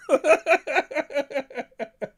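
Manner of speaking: high, loud giggle; high laugh. Real, not acted. Streamer laughing hard at the egg carton memes. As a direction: let the laugh burst out.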